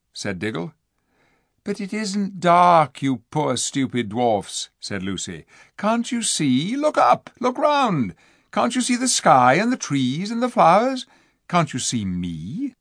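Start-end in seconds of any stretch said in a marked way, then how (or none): 1.6-12.7 pleading. Acted. Lucy pleading with blind dwarfs.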